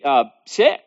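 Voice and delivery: ordinary talk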